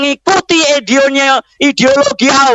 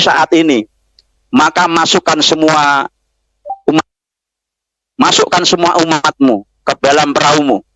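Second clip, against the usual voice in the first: voice of God commanding; 9 semitones lower than usual. Acted. God's command voiced in sermon.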